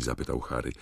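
plain reading voice

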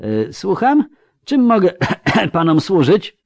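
put-on high, frail old man's voice with a cough; a polite, startled old man; old clerk. A narrator's reading. Old clerk answers intruders. (wysoki, słaby głos starca z kaszlem)

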